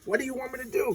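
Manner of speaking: high-pitched mock exasperation; imitated desperate protest; freaking out. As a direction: plead it in a frantic high voice. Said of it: "Thanos is just freaking out".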